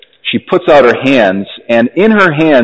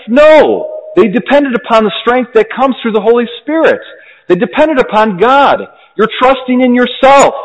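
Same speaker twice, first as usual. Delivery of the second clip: much higher, teasing and coaxing all through; friendly mocking tone; 10 semitones higher than usual. Virtue coaxing the doubting Augustine toward holiness, high and playfully mocking. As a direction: coax him on like a playful friend who knows he can do it. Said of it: "in a kind of a friendly, mocking tone".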